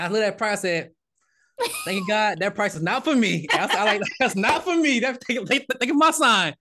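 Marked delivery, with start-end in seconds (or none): 1.8-6.5 high, loud, half-laughing plea to God; giddy mock prayer; laughing disbelief. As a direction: laugh it off to the heavens. Praying in disbelief at the price.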